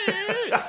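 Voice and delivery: high-pitched "meh" bleat of sobbing; mock crying wail. A boy crying over a broken necklace, mock wail. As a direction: squeal like a kid bursting into tears. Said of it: "I was a crier".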